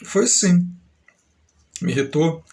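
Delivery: ordinary delivery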